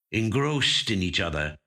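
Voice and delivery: usual speaking voice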